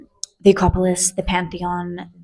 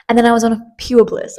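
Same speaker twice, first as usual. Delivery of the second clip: usual speaking voice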